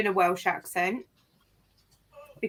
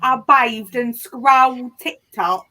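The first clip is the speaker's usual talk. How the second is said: put-on slow, louder copy of the friend's accent; imitating Smash's accent; 6 dB louder, slower than usual. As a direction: drag each word out in the friend's accent, a little louder. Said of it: "That's not how Smash talks".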